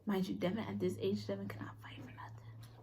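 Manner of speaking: soft whisper to the side; whispered aside. Real, not acted. Sister whispering a dig about her brother, whispered aside. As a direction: whisper it like a secret.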